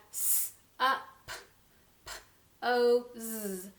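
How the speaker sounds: SUPPOSE broken into s, up, p, o, z; sounding out. Shown as a demonstration. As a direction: say s, up, p, o, z with gaps between sounds. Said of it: "So we have".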